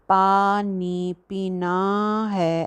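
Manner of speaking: every aa in खाना खा रही हूँ stretched far too long; overlong vowels. Shown as a demonstration. Teacher mocking an overlong alif in everyday Urdu. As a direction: drag out each long vowel, very slow. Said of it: "ऐसे नहीं कहते".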